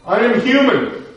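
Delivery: high, loud anguished cry; retold scream. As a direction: scream it out as a desperate plea for dignity. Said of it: "gathered himself and screamed out".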